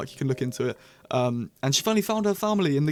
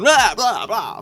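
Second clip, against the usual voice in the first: mock chatter; 7 semitones higher, 6 dB louder, much slower than usual. Mocking endless talking.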